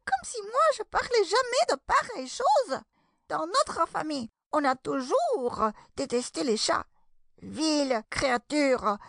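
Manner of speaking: high, squeaky indignant tirade; outraged mouse. A narrator's reading. Mouse scolding Alice for talking of cats, high-pitched and outraged. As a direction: rail like an offended little mouse. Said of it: "s'écria la souris, en tremblant".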